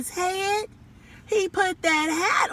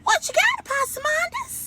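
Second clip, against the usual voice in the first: raccoon voice. A narrator's reading. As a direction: pitch it way up like a nosy raccoon. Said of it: "And the raccoon said".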